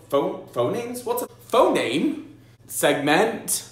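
rising high, disbelieving questions; mock bewildered parent repeating jargon; baffled parent. Parent puzzled by phonics terms, rising confused questions.

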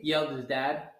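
ordinary delivery

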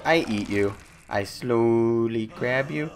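ordinary talk, nothing marked

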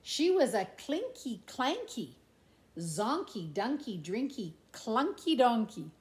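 plain reading voice

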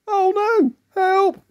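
very high storybook cry for help; panicked. Acted. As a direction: squeal in hammed-up alarm. Egg panics at turning duck.

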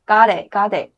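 "got it" run together, the t dropped, like "ga-lit"; linked got it. Shown as a demonstration. Teacher showing students how "got it" links in fast speech. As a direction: blend "got it" into one flowing sound with no t. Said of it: "我们在发音的时候有一些连读".

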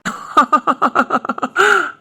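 high bright burst of laughter; amused laugh. Real, not acted. Friend laughs at the couple's baby rivalry. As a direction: laugh at the teasing.

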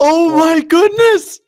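high, slow stunned cry; startled high wail after a blast; shocked exclamation. Real, not acted. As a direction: gasp it out in disbelief. Gasps after exploding.

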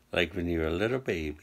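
ordinary talk

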